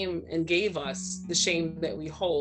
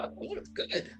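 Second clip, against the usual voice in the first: admiring self-quote; 10 semitones higher, 8 dB quieter than usual. Retold or imitated. Acts out admiring her younger self.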